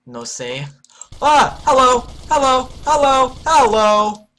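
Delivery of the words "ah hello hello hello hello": startled yelling. Real, not acted. Surprised by enemy, yelling.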